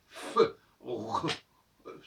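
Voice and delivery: "F, R" as fff-euh, rrr-euh, no leading E; bare consonant sounds. Shown as a demonstration. Teacher showing why E is added, "F, R" as raw consonants. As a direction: sound out "F, R" without the vowel in front. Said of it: "on met un E devant pour prononcer la consonne".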